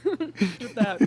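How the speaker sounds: bursting into laughter; laughing. Real, not acted. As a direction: laugh through the words. Cracking up at Karen joke.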